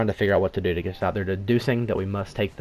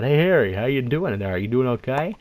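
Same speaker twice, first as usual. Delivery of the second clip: higher-pitched, sing-out check-in through a door; raised, questioning voice from the other side; guy outside the door; 5 semitones higher, 3 dB louder than usual. Retold or imitated. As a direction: imitate someone calling in to a friend. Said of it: "says from the other side".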